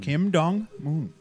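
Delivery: Kim Dong-moon said very slowly; slow, drawn out. Acted. Repeating the name slowly.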